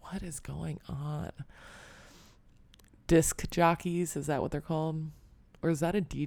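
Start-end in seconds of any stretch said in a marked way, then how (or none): 0.1-1.3 much softer, slow bewildered aside; baffled. Retold or imitated. Reacting to silly DJ names, a soft, slow, baffled aside. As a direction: mutter it, slowly and confused. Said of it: "And I'm like".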